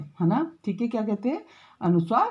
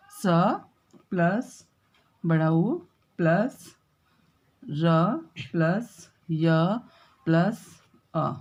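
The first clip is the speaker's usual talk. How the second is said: slow breakdown; 3 semitones lower, much slower than usual. Shown as a demonstration. Teacher breaks down Surya for students, slow and deliberate. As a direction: split Surya piece by piece, very slowly. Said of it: "विच्छेद करूँ इसका".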